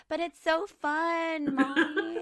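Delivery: put-on high, drawn-out kid whine, half laughing; pleading child. Son begging his mum to keep yelling, mock pleading.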